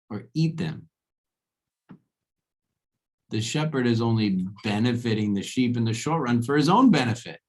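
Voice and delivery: loud, furious rant at fools; angry yell. Acted. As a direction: scream it down at the simple-minded crowd. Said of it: "he screams and he's like".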